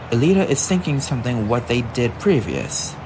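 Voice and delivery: plain reading voice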